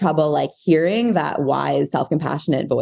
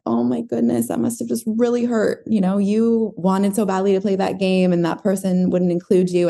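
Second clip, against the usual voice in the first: loving parent voice; 4 semitones higher than usual. Retold or imitated. Mother consoles child left out at recess, loving. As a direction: soothe a hurt child in a higher, tender voice. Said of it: "your self-compassionate voice or your loving voice".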